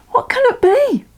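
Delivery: put-on very high, frightened child; alarmed girl. Molly afraid of the noise, very high frightened voice. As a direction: squeak it out in fear. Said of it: "cried Molly".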